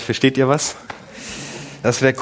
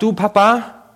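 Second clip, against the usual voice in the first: put-on higher, small child's voice asking; imitating young son; 8 semitones higher than usual. Son calling to his dad from the back seat, higher child voice. Son leaning forward in car.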